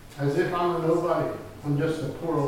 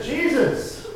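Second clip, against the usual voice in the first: high, louder call to Jesus; beggar's raised, pleading call; impulsive cry; 11 semitones higher, 5 dB louder than usual. Acted. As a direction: call out as if you can't help it. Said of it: "I just couldn't help myself".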